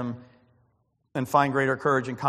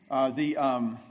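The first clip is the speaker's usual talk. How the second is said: ordinary talk, nothing marked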